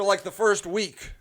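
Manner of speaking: normal talking voice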